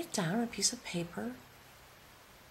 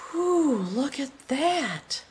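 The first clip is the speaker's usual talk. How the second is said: amazed whew; 6 semitones higher, 6 dB louder, much slower than usual. Real, not acted. Overwhelmed by the card.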